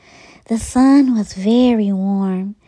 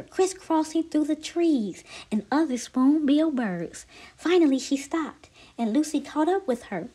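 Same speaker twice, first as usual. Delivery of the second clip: plain reading voice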